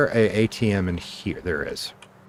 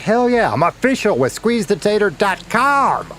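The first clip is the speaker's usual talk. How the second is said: high, loud hell yeah; excited cheer; 11 semitones higher, 3 dB louder than usual. Retold or imitated. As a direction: whoop it like you just scored a win. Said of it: "So I was like".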